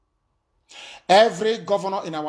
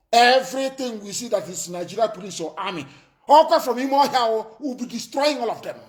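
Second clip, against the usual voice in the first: rising shout; 6 semitones higher than usual. Real, not acted. Threatening the police and army, building to a shout. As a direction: build to a fierce yell.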